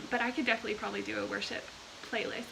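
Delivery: normal talking voice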